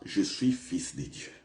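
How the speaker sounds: ordinary delivery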